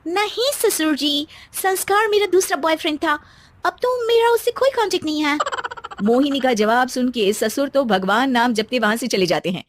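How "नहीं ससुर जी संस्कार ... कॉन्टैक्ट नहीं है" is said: cheeky spoilt bride. Acted. Bride cheekily answering her father-in-law, high and mocking. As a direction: toy with the old man.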